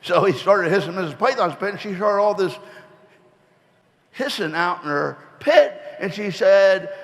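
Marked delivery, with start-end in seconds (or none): none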